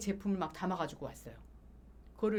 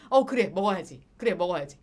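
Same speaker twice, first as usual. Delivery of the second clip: forced agreement; 2 semitones lower, 8 dB louder than usual. Retold or imitated. Giving in to sister loudly.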